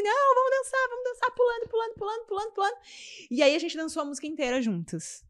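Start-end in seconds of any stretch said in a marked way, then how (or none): 0.0-2.8 very high, bouncy urging; retold eager coaxing. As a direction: coax a shy friend to dance, chanting it high. Retells coaxing her friend to dance.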